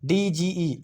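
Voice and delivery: slow letter-by-letter spelling; teacher spelling out a word; spelled out slowly. Shown as a demonstration. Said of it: "यहाँ पे एडज नहीं है".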